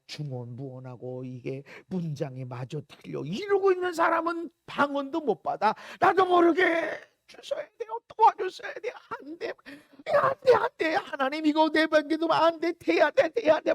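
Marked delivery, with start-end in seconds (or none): 6.0-13.7 desperate crying out. Acted. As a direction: beg God urgently, repeating yourself. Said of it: "막 이렇게 부르짖을 때". (다급하게 부르짖는 기도)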